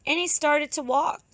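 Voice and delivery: ordinary delivery, nothing marked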